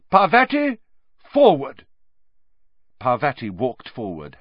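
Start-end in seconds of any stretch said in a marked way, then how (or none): 0.1-1.9 loud, high calling command; shout. A narrator's reading. Professor summoning the next pupil to the boggart, shouted. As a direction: call the pupil up sharply and loudly. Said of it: "Professor Lupin shouted".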